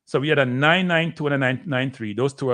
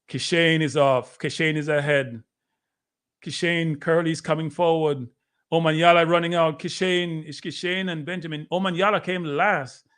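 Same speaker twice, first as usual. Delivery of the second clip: rising, excited play-by-play at a higher pitch; live race call; 3 semitones higher than usual. Acted. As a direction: call the sprint as it happens, building. Calls the race replay.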